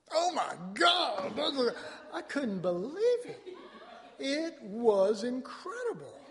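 high, loud, slow exclamation with laughter; amazed delight. Retold or imitated. The preacher reliving his first bite, loud and laughing. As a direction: relive the shock of loving it. Tasting asparagus for the first time.

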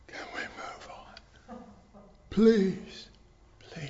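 imitating a congregation's hushed begging; whispered plea. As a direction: plead under your breath, one "please" briefly voiced. Imitating a weary congregation pleading.

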